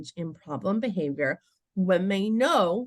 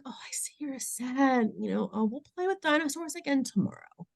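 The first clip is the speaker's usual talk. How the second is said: higher, gentle consoling voice; sympathetic comforting; 6 semitones higher, 4 dB quieter than usual. Shown as a demonstration. Adult consoling a child upset at clean-up time, gently sympathetic. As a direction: console a little one softly.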